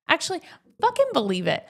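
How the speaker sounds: high, loud self-correction; emphatic correction. Real, not acted. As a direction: punch it as you catch yourself. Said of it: "wait a minute".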